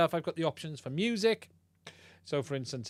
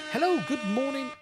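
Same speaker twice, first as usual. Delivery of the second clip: pitched-up effect voice; voice changer; 10 semitones higher than usual. Shown as a demonstration. Streamer demoing his new voice box, high filtered voice. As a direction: greet them through a high voice filter. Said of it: "allows me to do things like this".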